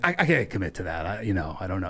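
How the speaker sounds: a lightly voiced hedging guy; evasive mumble. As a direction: back away from the question. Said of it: "he's like".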